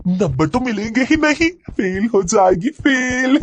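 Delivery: high, sing-out deranged jeer; mad girl's taunting. A narrator's reading. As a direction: crow it at them, last word stretched high. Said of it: "भागते हुए भी वो यही बात कह रही थी".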